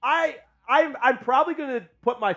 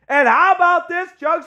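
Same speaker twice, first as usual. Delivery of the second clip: hyped-up catchphrase; 8 semitones higher, 6 dB louder than usual. Acted. Catchphrase hyped for super chat.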